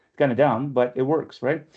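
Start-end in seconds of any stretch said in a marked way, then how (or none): none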